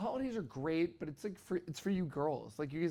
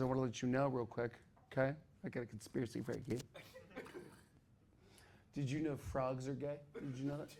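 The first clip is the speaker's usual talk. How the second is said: soft, lower, conspiratorial; secretive hush; 5 semitones lower, 9 dB quieter, faster than usual. Retold or imitated. Brother sharing his frog conspiracy, low secretive hush. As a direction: whisper it like a big secret. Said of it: "He goes".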